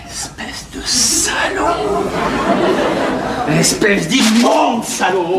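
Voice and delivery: slow, drawn-out furious insults; seething anger. Acted. Man cursing his brother, slow seething anger. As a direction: stretch each insult out in rage. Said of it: "Non, enfin, calme-toi".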